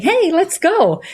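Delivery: high, drawn-out cheer of self-encouragement; encouraging cheer. Pretend. Coach showing how to hype yourself up, encouraging.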